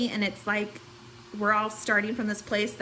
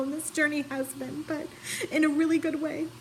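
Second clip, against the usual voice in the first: tearful, voice catching, pitched up; crying; 6 semitones higher than usual. Real, not acted. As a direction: push through the words while crying. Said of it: "Chelsea warned me it was gonna be emotional".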